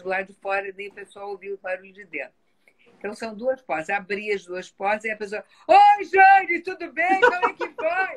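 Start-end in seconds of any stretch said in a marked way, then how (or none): 5.7-8.2 shouted cheerful hello, much higher; loud greeting. Retold or imitated. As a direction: greet her as if across a big auditorium. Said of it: "você não tá num auditório de duzentos lugares".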